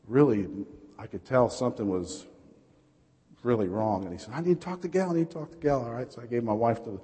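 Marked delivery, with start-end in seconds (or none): 4.3-5.8 mildly panicked, high-pitched plea; retold urgent demand, pitched up; panicking. Brother-in-law phoning early with bad news, panicking.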